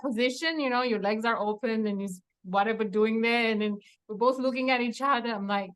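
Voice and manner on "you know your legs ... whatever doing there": ordinary talk, nothing marked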